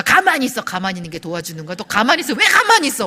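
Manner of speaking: hammed-up exasperated nagging; mock scolding. Wife scolding husband who offers help, mock exasperated.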